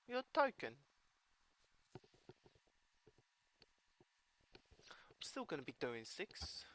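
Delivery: put-on British accent through the whole game commentary. Narrating his card game to viewers in a British accent. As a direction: play the match like a chatty British commentator. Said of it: "I hope you all enjoyed, uh, the British accent".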